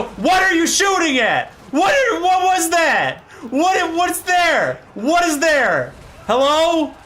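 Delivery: loud, high-pitched disbelief climbing to a yell; incredulous shouting. Real, not acted. Streamer to a misfiring tank, incredulous shouting. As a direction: demand answers in escalating bewilderment. Baffled reaction to game bug.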